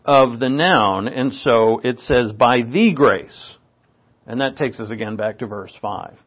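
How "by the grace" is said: "the" said as a long, deliberate "thee"; slow phrase, leaning on "the"; stress on "the". Shown as a demonstration. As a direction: draw out "the" to single it out. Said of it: "it emphasizes the identity".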